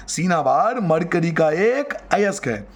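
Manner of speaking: slow, measured repetition for note-taking; slow dictation. Shown as a demonstration. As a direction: spell it out slowly, word by word. Repeats it slowly for the class.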